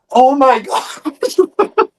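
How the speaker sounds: shocked, laughing gasp; high, slow laughing disbelief; horrified. Retold or imitated. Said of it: "I was horrified".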